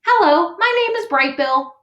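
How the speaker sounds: high-pitched, loud chick greeting; little gosling voice. Acted. Gosling greets neighbours down a hole, high little voice. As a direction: pipe a greeting as a baby bird. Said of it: "say to whoever was there".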